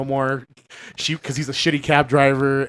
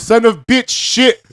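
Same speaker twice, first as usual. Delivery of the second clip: slow, higher mock foreign accent, each word dragged; mock broken English; 7 semitones higher, 4 dB louder, slower than usual. Retelling a movie scene to friends, slow mock foreign accent. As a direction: ham up a halting foreign accent, slowly. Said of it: "He goes".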